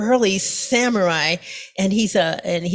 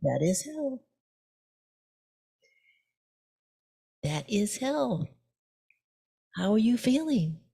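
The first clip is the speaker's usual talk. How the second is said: very soft and hushed, slowing on the second line; very softly; 8 dB quieter than usual. Acted. Monk answering the raised sword, very softly. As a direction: say it almost under the breath, calm. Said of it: "the monk says very softly".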